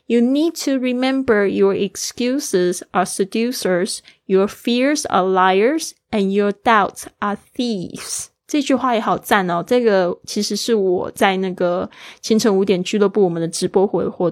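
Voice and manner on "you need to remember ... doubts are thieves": slow model reading. Shown as a demonstration. Teacher reading the quote to students, slow and clear. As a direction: read each phrase slowly and clearly. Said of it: "我们来听一下这句话".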